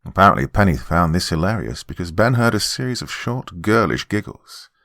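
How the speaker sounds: ordinary delivery, nothing marked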